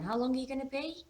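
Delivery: high, quick, rising question; questioning teenage daughter. A narrator's reading. Daughter questions dad anxiously.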